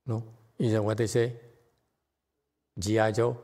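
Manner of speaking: normal talking voice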